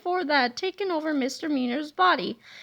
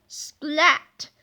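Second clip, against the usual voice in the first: ordinary delivery, nothing marked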